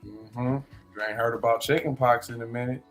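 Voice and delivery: ordinary delivery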